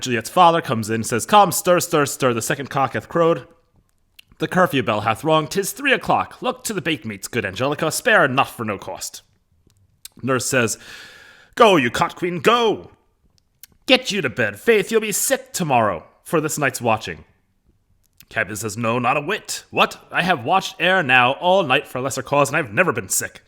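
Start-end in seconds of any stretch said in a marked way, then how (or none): 1.3-9.2 loud, high theatrical ordering; bustling Capulet. Acted. 11.5-17.2 loud, high-pitched theatrical scolding; scolding nurse. Acted. Acting Nurse scolding Capulet. 18.8-23.4 loud, raised-pitch stage retort; protesting Capulet. Acted. Acting Capulet's reply to nurse.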